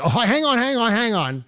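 Sean Hannity. Retold or imitated. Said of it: "this is where Sean Hannity is like".

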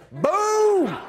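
loud high Boom; triumphant shout. Acted. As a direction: slam it down like a win.

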